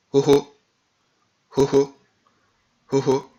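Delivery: "Ho ho" as if nothing came before it; Ewe X. Shown as a demonstration. Teacher shows learners the X is not French, "Ho ho" breathed. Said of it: "comme s'il n'y avait rien devant".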